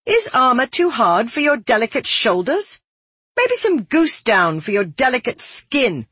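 high, goading mock concern; mocking taunt. A narrator's reading. Blacksmith's daughter mocking Savar's delicate skin, high taunt. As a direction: needle them with sugared offers, pitched high. Loud public mockery of Savar continues.

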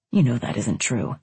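low-pitched man's line; man's reply. A narrator's reading. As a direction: say it low, at an ordinary volume. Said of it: "he said again".

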